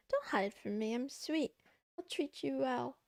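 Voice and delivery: usual speaking voice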